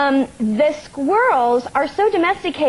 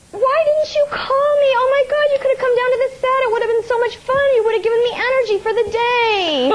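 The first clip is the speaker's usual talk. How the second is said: high, fast, gushing imitation; gushing Tom Cruise; 10 semitones higher, faster than usual. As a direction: play the overeager star, breathless with delight. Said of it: "And he goes".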